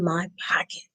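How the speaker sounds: hushed secret whisper to the class. Acted. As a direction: breathe it like a little secret. Teacher reading story aloud, aside.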